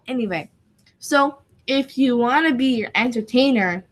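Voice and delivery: ordinary talk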